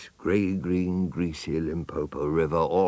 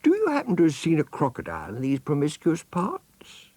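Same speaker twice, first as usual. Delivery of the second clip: put-on high, polite child voice; polite elephant's child; 11 semitones higher, 4 dB louder than usual. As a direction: ask innocently and politely. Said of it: "said the elephant's child most politely".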